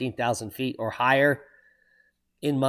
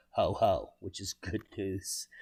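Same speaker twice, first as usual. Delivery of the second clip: Santa laugh; 5 semitones lower, 10 dB quieter than usual. Pretend. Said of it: "Thank you, Santa".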